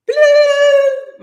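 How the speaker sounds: loud, high-pitched phone bloop; sudden loud electronic ring noise; imitated phone ring. Imitating her phone suddenly ringing.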